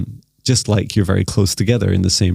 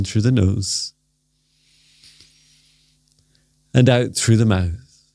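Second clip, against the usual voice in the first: guided meditation. Acted. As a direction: gently lead them into stillness.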